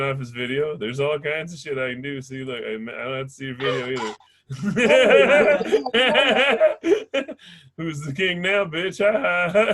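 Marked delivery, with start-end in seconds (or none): 4.5-7.6 big triumphant cackle; gloating laugh. Real, not acted. Crowing laugh at the host after the video trick. 7.8-9.7 taunt. Real, not acted. Gloating taunt.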